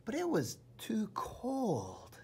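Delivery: ordinary delivery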